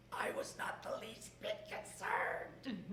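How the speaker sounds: strained, very soft whisper; hushed, whispery denial of worry; mock unconcerned. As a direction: whisper it as if unworried. Feigned calm about test result.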